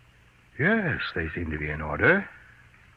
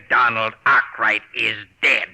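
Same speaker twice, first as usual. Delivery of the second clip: triumphant shout; 5 dB louder, slower than usual. Acted. Husband gloats over killing rival.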